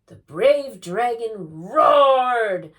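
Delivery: very loud, slow, rising to a drawn-out roar; booming. Acted. Boy reading aloud to lure the dragon, booming. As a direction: stretch the last word into a roar. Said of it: "read aloud in a booming voice".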